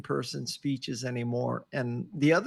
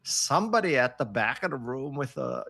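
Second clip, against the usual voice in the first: normal talking voice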